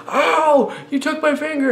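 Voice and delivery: high, loud cry of pain; mock scream. Teasing a little kid holding the hand, mock scream. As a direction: scream it high, as if freshly hurt. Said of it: "I screamed".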